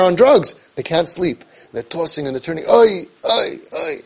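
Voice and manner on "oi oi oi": imitated groaning. Imitating a sleepless teen, mock groaning. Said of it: "and they're krechzing".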